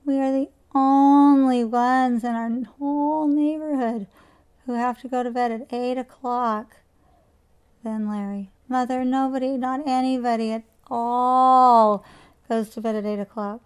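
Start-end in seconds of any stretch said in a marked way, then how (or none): 0.0-6.8 put-on loud, pleading child's whine; whining child. A narrator's reading. Children whining to their mother to stay up late. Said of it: "whining and complaining". 8.7-13.6 put-on loud, pleading child's whine; whining child. A narrator's reading. Children whining to their mother to stay up late. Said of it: "whining and complaining".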